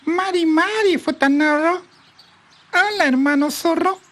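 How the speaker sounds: high, wary little bird voice; nervous partridge greeting a fox, high and wary. A narrator's reading. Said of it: "muy desconfiada lo saludó diciendo".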